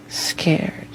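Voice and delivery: softly whispered "Scared.". Real, not acted. Recalling childhood fear, whispered. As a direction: breathe the word out under the breath.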